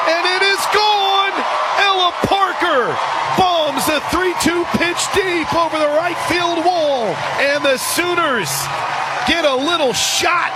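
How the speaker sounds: full-throated excited play-by-play shout; home run call. Real, not acted. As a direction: let it rip like the ball is leaving the yard.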